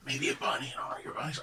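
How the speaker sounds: quick unvoiced whisper; hurried whispered aside. Real, not acted. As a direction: toss it off under your breath, fast. Whispered joke suggestion.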